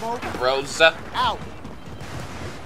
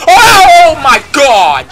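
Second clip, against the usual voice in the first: anguished yell; 7 semitones higher, 11 dB louder, much slower than usual. Real, not acted. Dies in game.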